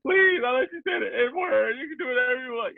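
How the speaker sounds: high-pitched fake crying; mock sobbing plea. Customer begging clerk for the toilet, mock sobbing. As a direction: beg through hammed-up sobs. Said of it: "You start crying".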